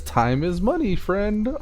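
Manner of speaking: high, lightly voiced goblin quote. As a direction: squeak it like a greedy goblin. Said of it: "The goblin says".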